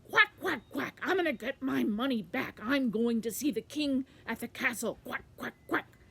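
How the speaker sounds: loud quacking duck, punched out. Acted. Quackling answering the ladder about the castle, loud duck voice. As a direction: announce it in noisy duck quacks. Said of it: "he was very loud".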